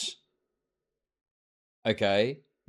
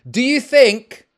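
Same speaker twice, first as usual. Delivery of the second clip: louder and higher, genuinely furious at him; angry shouting; 3 semitones higher, 7 dB louder than usual. Real, not acted. Streamer yelling at a chatter over the title, furious shout. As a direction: bark the question at him, fed up.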